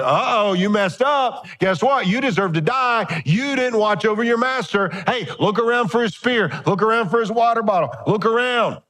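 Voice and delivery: high, teasing gotcha voice; mocking taunt. David teasing Abner that he failed to guard Saul. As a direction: rub it in playfully. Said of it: "And he's like".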